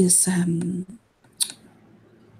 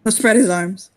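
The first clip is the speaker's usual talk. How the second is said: ordinary delivery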